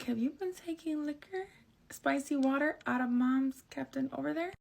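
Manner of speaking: high, soft, sugary interrogation; mock sweet question. Sister sweetly grilling her brother, mock innocent. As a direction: fake innocent voice to trap him. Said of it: "I'm like".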